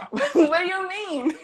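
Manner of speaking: high, baffled question through a laugh; laughing in disbelief; puzzled and amused, voice jumping up; confused surprise. Retold or imitated. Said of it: "And I'm like".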